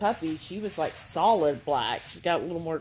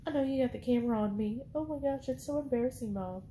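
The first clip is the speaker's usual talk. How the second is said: high, soft, embarrassed dog voice; dog's voice; 6 semitones higher, 8 dB quieter, faster than usual. Pretend. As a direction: whine softly as the camera-shy dog. Voicing the shy dog.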